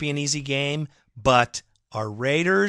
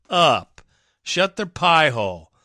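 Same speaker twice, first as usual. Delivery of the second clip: ordinary talk, nothing marked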